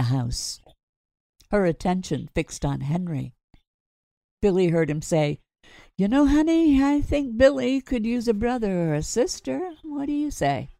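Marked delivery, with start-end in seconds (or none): 6.0-10.7 playful husband. Acted. Henry flirts with wife.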